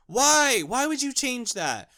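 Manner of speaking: loud disbelieving why; exasperated outburst. Real, not acted. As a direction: throw up your hands at it. Exasperated rant question.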